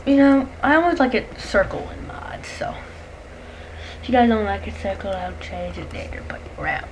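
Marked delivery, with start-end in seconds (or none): 1.1-2.7 soft whispered aside. Real, not acted. Whispered aside about mods. 5.1-6.9 soft whispered aside. Real, not acted.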